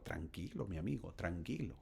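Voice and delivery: soft, quiet "easy, my friend"; preacher soothing an impatient listener softly; calming reassurance. Acted. Calming the imagined objector.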